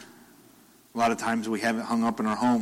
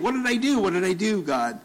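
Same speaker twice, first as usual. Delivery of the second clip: confused pleading; 8 semitones higher than usual. Retold or imitated. Confused Job pleading with God about his suffering. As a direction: plead with God, lost and hurt. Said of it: "he starts questioning it".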